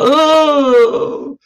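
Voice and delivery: loud high mock shudder; mock horrified yelp. Vlogger mocking a politician's looks, loud high yelp. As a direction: yelp it out in hammed-up disgust.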